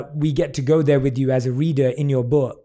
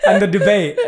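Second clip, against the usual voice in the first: laughing; 4 semitones higher, 4 dB louder than usual. Real, not acted. Laughing over shared joke.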